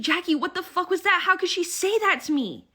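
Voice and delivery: high, loud, upset outburst; freaking out. Retold or imitated. Emmett calling Jackie after the dinner, freaking out. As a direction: imitate a friend panicking in disbelief. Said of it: "he is freaking the fuck out".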